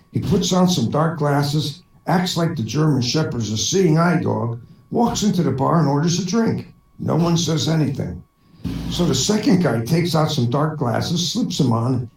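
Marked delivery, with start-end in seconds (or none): none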